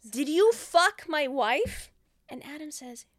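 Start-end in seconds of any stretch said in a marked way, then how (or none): 0.1-1.9 slow, louder accusing yell; retold yell. Man confronting his double, loud accusing yell. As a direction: demand it, man to man. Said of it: "he's yelling at his mirror".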